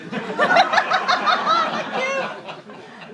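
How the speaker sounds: bursts out laughing, high and loud. Real, not acted. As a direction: cracks up laughing mid-sentence. Laughs mid best man speech.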